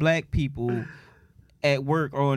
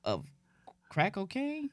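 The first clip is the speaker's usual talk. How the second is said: incredulous; 3 semitones higher, 10 dB quieter than usual. Retold or imitated. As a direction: act shocked at the offer. His disbelieving reply to crackhead.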